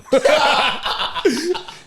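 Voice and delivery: a real high-pitched laugh at the joke. Real, not acted. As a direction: burst out in a high cackle. Laughing at imagined Oscar slap.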